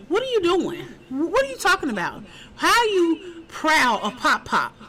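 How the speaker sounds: high, disbelieving sister telling him off; mock-shocked sister, half laughing; incredulous scolding. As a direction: scold him like you can't believe your ears. Sister scolding brother, retold.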